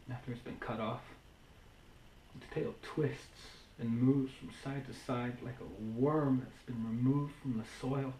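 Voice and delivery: very soft, low and halting; hushed, lonely lament for a lost love; quiet heartache. Acted. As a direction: confide the loss softly, with long pauses. Monologue, mourning partner who left.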